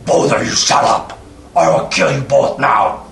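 loud orc bellow, dropping low; orc shouting. Acted. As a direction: bark it like an angry orc jailer. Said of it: "they're like".

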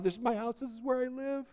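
high, beaming pride; proud castaway. Acted. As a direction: boast gently about his own home. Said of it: "He was so proud".

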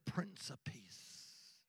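slow hushed reverent whisper. Acted. Preacher revealing Christ to the crowd, whispered slow. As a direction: breathe it slowly. Dramatic sermon hush.

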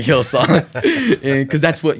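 words broken by laughter; laughing. Real, not acted. Laughing at own music taste.

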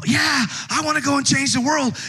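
high shouted excitement; mock fired-up believer; hyped up. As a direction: gush about changing the world. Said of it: "the emotionalism of".

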